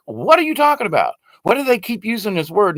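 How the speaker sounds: high-pitched baffled questioning; incredulous reaction. Retold or imitated. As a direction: imitate your own disbelief at the screen. Retold reaction to a video.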